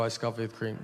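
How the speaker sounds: low, restrained repeat of the order; confirming reply. Retold or imitated. Customer confirms the iced coffee order, low patient reply. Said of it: "I go".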